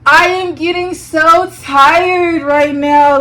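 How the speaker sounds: hammed-up drowsy brush-off, drawn out; fake sleepy. Dodging her coworker's advance, slow fake sleepy sigh. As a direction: yawn it out to dodge him. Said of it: "And I was just like".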